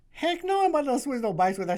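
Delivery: loud, high, fast huffy refusal; indignant refusal. Retold or imitated. Wife asks him to switch bikes, he refuses with a loud high outburst. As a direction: blurt it out, outraged at the idea. Refusing wife's suggestion loudly.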